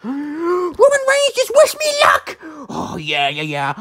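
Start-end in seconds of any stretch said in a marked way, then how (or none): 0.0-2.4 loud high squeal; high excited shout. Pretend. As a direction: shriek it in excitement.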